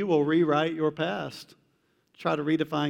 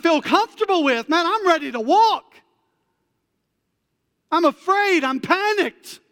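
high, loud, pleading prayer of a panicked believer; lightly voiced fretting believer; panicky believer's prayer; 9 semitones higher, 5 dB louder than usual. Believer to God, a high loud panicky plea.

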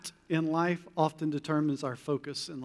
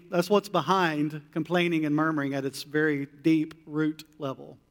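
ordinary talk, nothing marked

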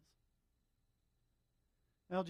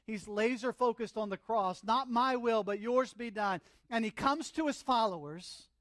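loud, raised preaching voice; impassioned preaching; 6 semitones higher, 9 dB louder than usual. Acted. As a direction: preach it with high, driving intensity. Preacher intensifies the Gethsemane scene.

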